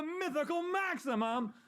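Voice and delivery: mythical maximum held high and slow; grand comic flourish. Pretend. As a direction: stretch the words out high like a grand fanfare.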